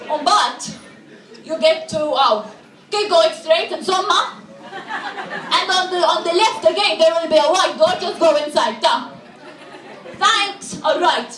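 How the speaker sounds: put-on halting Maltese-accented directions; blunt, broken Maltese English. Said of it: "a Maltese person who doesn't speak English very well".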